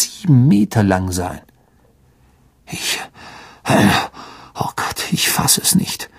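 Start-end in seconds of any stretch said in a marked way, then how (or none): 2.7-6.1 breathless stunned whisper, barely voiced; faint whisper. A narrator's reading. Ron, shaken, staring at the snake skin: faint whisper. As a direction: let it drain out in a faint whisper. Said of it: "sagte Ron matt".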